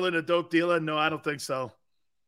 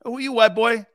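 mock challenge; 4 semitones higher, 6 dB louder than usual. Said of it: "Those are the boys I played with".

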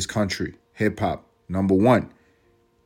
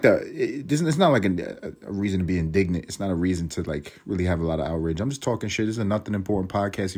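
normal talking voice

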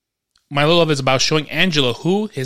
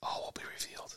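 very soft, teasing whisper; mysterious whisper; 22 dB quieter than usual. Pretend. Host teasing listeners about what is coming, mysterious whisper. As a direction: whisper it like a secret being kept.

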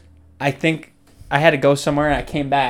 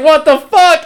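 high and louder, baffled; rattled shock; 12 semitones higher, 8 dB louder, slower than usual. Retold or imitated. Friend rattled to his core, high shocked outburst. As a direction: blurt it out, shaken. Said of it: "I was like".

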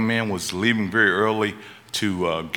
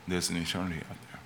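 the repeat dropped much softer, near a hush; hushed echo; 3 semitones lower, 12 dB quieter, faster than usual. Shown as a demonstration. Preacher echoing his warning to the congregation, much softer. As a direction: let "There's an eternity out there" fall away softly. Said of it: "repeated line, second dropped".

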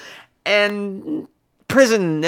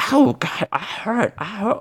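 mock moaning; 3 semitones higher, 4 dB quieter, slower than usual. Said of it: "he started being like".

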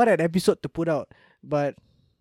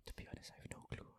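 soft unvoiced whisper; 26 dB quieter, faster than usual. Real, not acted. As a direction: whisper it under your breath. Whispers unsure answer.

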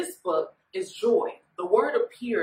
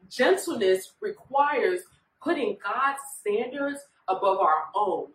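ordinary talk, nothing marked

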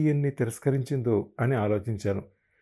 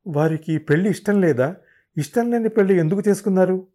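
higher, louder, fast demand; heated; 7 semitones higher, 5 dB louder than usual. Retold or imitated. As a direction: fire the questions hotly. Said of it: "ఆవేశంగా అడిగాను".

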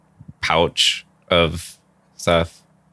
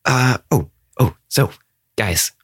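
lightly voiced cute-struck character; a touch of an excited game character; in-character creature; 3 semitones higher than usual. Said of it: "And she's like".

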